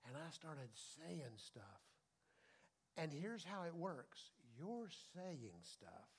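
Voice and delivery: slow, soft and low; quiet wonder, slowed right down; hushed awe. Real, not acted. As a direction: let it drop to an awed hush. Hushed recall continues.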